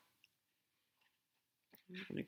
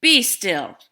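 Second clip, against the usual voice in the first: Max commanding; 3 semitones higher, 13 dB louder than usual. Acted. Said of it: "Till Max said".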